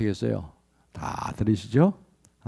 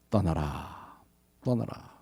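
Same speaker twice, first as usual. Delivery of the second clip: hushed call; 7 semitones lower, 12 dB quieter, much slower than usual. Acted. God's call voiced softly.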